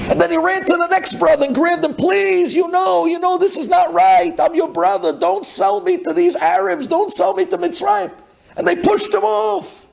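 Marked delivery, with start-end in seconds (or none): 1.9-8.1 begging. Retold or imitated. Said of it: "He was begging, he was crying, he was pleading".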